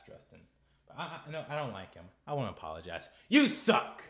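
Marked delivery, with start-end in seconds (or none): 3.3-4.0 sudden shout of contempt; loud scornful yell; angry outburst. Real, not acted. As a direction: yell it at him. Ranting at Justin.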